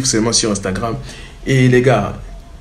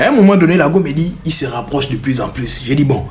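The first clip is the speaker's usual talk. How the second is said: ordinary talk, nothing marked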